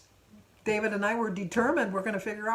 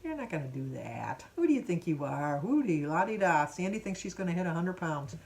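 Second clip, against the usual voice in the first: mocking inner critic; 2 semitones lower than usual. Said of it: "it's me telling myself".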